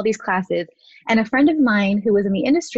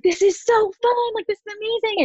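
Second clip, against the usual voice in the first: high-pitched retold excitement; gushing, high replay of a thrill; 13 semitones higher than usual. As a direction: reenact giddy delight, voice way up. Retold thrill at first shoot.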